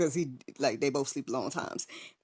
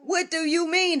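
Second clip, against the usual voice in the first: disbelief; 12 semitones higher, 4 dB louder than usual. Retold or imitated. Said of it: "I'm sitting here like".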